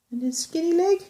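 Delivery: ordinary delivery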